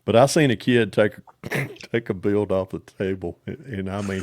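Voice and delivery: normal talking voice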